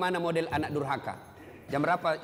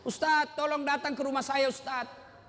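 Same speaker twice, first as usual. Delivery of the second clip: high-pitched pleading, lightly acted crying; mock tearful appeal that sinks away; imitated tearful plea; 7 semitones higher than usual. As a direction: play a weeping woman begging for help. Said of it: "tiba-tiba ada ibu-ibu menangis".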